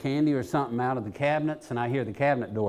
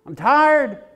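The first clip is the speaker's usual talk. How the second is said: higher, louder fed-up cry; retold screaming; 11 semitones higher, 6 dB louder, slower than usual. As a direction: cry out at your limit. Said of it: "I started screaming at 'em".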